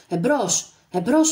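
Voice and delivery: loud, rising battle call; shouting forward. A narrator's reading. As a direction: urge the men on at full voice. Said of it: "φώναζε ο Άγρας". (δυνατή κραυγή «εμπρός»)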